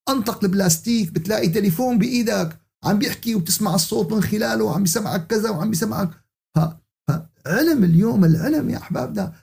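ordinary talk, nothing marked

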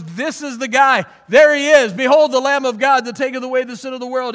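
high, ringing herald's cry; loud proclamation. Acted. Preacher as John the Baptist pointing out Jesus, proclaiming loudly. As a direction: proclaim it at full volume, pitched high.

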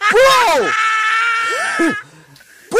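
cracking up and shouting; laughing shout. Real, not acted.

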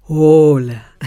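low, booming chest voice; dark chest color. Shown as a demonstration. Voice teacher exaggerates vocal color, low loud chest voice. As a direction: sink the voice deep and boom from the chest. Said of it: "esto que resuena tan en el pecho".

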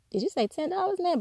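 high, incredulous but friendly question; friendly disbelief at the price; amused shock at the price, not rude; surprised, not rude. Retold or imitated. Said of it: "But not in a rude way".

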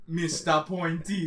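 slow, playful fake Jamaican accent; mock Jamaican accent. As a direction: stretch out a silly Jamaican accent. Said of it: "how to do that accent".

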